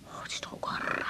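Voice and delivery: bitter whisper. Acted. Wife muttering about Frank.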